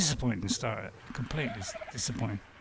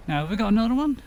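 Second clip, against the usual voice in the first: "have we got another one" asked in a sudden much higher voice; 7 semitones higher, 4 dB louder, faster than usual. Real, not acted. Streamer checking his inventory, blurting it out in a high voice. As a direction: pop the question up high, unplanned. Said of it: "I said that in a high voice".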